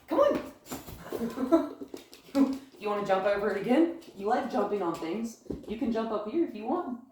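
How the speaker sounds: high, bright voice for the dog; coaxing dog talk. Real, not acted. Trainer coaxing her dog onto the couch in high dog talk. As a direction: coax him up playfully.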